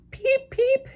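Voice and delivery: tiny, very high chick peeps; baby chick peeping. Acted. Chick in the egg calling out to its fathers, high peeping. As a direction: peep like a chick inside its egg. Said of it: "answered the egg".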